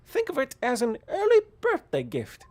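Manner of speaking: put-on high, loud scolding old mother; shrill disapproving mom voice; old lady voice. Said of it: "old lady voice".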